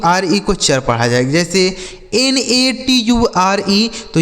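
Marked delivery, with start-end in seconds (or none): 2.1-3.9 slow, higher letter-by-letter spelling; each letter given its own beat. Shown as a demonstration. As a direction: spell it out slowly, pitched up. Spells words out for learners.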